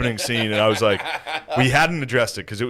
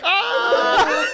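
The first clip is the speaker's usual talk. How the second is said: sudden high laughing yell; playful shout; 3 dB louder than usual. Retold or imitated. Face to face with Six, a high laughing yell. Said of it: "just like".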